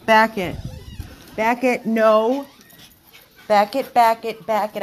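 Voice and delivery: loud command to the dogs; firm command. Real, not acted. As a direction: push them back from the door. Ordering dogs back.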